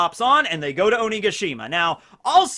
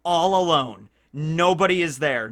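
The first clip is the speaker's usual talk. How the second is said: normal talking voice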